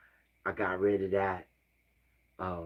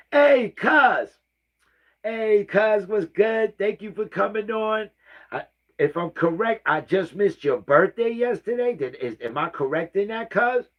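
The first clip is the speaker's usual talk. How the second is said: high, loud, bursting hello to a cousin; excited loud greeting; 11 semitones higher, 6 dB louder than usual. Real, not acted. Greeting his cousin on her birthday, excited and much louder. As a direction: shout a delighted greeting across the room.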